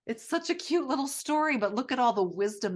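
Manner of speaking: high, delighted gushing. Real, not acted. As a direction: gush like it's adorable. Gushing over the book.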